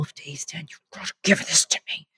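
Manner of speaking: breathy pleading whisper; whispered plea to Dan after long days. Real, not acted. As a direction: beg in a tired whisper.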